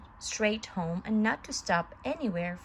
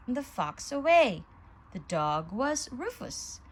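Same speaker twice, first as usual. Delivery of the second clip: ordinary delivery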